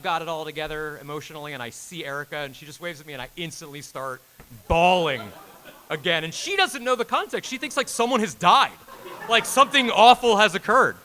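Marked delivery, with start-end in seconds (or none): 4.7-6.2 laughing through the words, much louder; can barely get it out for laughing; breaking up with laughter mid-story; laughing hard. Real, not acted. Retelling crying in front of Erica. 6.2-11.0 much higher and louder, half laughing; giddy rush of the retelling; excited. Real, not acted.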